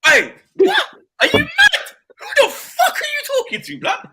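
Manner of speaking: high-pitched laughing taunt; mock outrage. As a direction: shriek it like a wound-up rival fan. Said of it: "I'm like".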